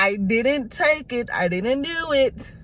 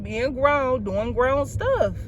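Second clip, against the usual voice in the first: ordinary talk, nothing marked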